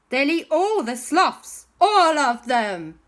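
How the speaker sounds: high, loud, drawn-out alarm; alarmed warning. Acted. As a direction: stretch it out in mock horror. Owen warns of disaster.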